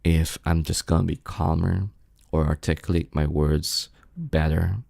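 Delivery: low, soft, carefully articulated; composed indoor voice, pitched low; quiet, calm voice. Shown as a demonstration. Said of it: "if my demeanor is a bit quieter".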